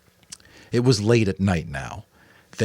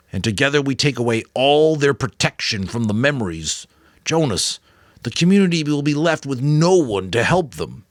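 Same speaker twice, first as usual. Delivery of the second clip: put-on raised, urgent old mentor pleading his case; the Giver, earnest elder; 6 semitones higher, 5 dB louder than usual. A narrator's reading. The Giver explaining to Jonas why he must stay, earnest and urgent. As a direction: press the boy to understand the stakes.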